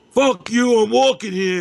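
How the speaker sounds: yelling it high and loud at a honking car; angry shout. Retold or imitated. Jaywalking friend yells back at a honking car, loud shout. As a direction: bark it back like a New York jaywalker. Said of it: "and he's like".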